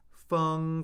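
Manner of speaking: "Feng" held high and flat; first tone. Shown as a demonstration. Teacher cycling four tones on a syllable, "Feng" high and flat. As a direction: say "Feng" on a high, level pitch. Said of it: "Let's do the four tones".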